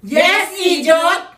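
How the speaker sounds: usual speaking voice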